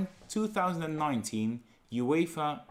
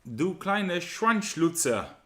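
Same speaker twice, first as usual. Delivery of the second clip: mock German insult; 5 semitones higher, 4 dB louder, slower than usual. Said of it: "Schöne Sprache Deutsch, nicht?".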